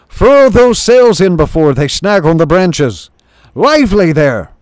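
loud, high urgent command; shouted orders. Acted. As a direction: bellow it over the crew. Chief shouting orders on deck.

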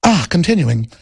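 hammed-up British-tinged intellectual; intellectual vernacular. Host reading an article as a snobbish intellectual, affected. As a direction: read pompously, rising and stretching key words toward the end. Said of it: "I'll be intellectual today and speak in this vernacular".

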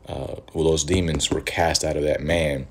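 ordinary talk

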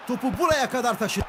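ordinary talk, nothing marked

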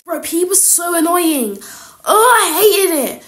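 high, exaggerated moaning; whiny complaint. Pupil moaning to friends about P E, whiny complaint. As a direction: wail about it, dragging out the end.

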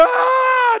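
loud, very high mimicked wail; imitated child's scream. Preacher imitating a child someone approaches, screaming. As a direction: burst into a child's screaming cry. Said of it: "They're just, just screaming".

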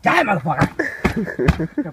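yelled loud and slow, all-out; battle yell. Retold or imitated. Bashing the skunk with a crutch, roaring war cry. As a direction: roar it as you swing at the beast.

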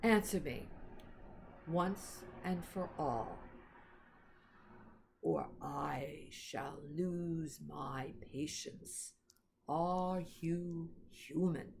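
slow, low, cold and impatient; a lightly voiced stern queen. A narrator's reading. Queen losing patience with Edmund, low and menacing. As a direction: let the threat hang, in a low voice.

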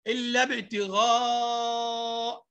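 إلا ابتغاء with the last long a stretched out; long madd. Shown as a demonstration. Teacher showing madd to pupils, stretched ابتغاء. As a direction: hold the alif of ابتغاء very long. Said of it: "مديت المد".

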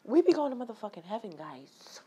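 fast whispered aside. Real, not acted. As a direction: lean in and whisper it quickly to the viewers. Conspiratorial aside to viewers.

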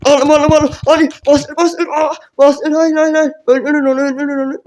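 high, loud, frantic cries in the heat of the fight; high excited shouting at teammates; frantic game yelling. Real, not acted. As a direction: yell like the match hangs on it. Frantic moment in close fight.

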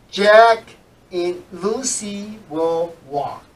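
slow, clear reading with reduced and; slow model reading. Shown as a demonstration. Teacher reads example two slowly to the class. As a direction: read it slowly for learners. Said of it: "Let's look at number two".

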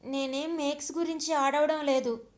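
plain reading voice

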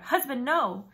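shouting. A narrator's reading. Wife tackles husband to stop.

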